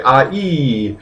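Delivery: "ah, ee" with a small break between; separated vowels. Shown as a demonstration. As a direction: keep the two vowels apart. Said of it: "taking a little pause to separate that".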